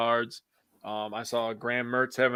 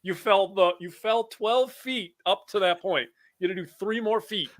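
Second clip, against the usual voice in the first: higher, incredulous pleading with a player; retold exasperation; 6 semitones higher than usual. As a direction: plead with the player in mild disbelief.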